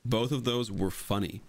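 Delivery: normal talking voice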